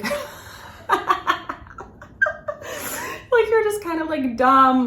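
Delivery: high voice squeezed by laughter; laughing fit. Real, not acted. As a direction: try to explain while cracking up. Cracks up defining an insult.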